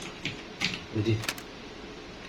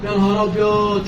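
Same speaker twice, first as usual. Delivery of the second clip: a touch of real fright, raised pitch; startled; 7 semitones higher than usual. Real, not acted. Gamer telling viewers how scared he is, startled. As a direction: gasp it out while still shaken. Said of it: "شفتوا الفزعة يا جدعان اللي أنا فيها".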